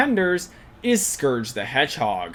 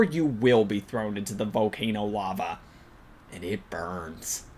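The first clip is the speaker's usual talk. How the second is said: mock-ominous aside; 7 semitones lower, 6 dB quieter than usual. Mock threat of volcano lava.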